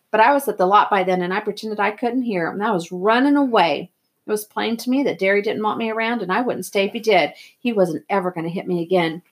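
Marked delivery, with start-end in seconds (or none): none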